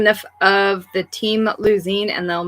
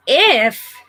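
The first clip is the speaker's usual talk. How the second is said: "If." stressed, pitched up and punched; pointed "If"; 4 semitones higher, 3 dB louder than usual. Real, not acted. Correcting her cohost's "when" with an emphatic "If.".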